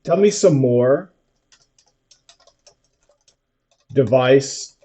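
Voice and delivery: slow, careful dictation to a voice assistant; dictating. Shown as a demonstration. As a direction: spell it out word by word for the machine. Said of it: "I'm gonna say".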